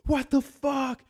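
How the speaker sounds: high, loud mock alarm; freaked-out yell. Staff reviving a passed-out donor, freaked-out yell.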